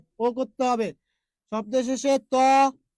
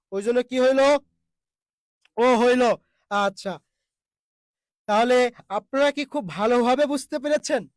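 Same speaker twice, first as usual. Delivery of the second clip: ordinary talk, nothing marked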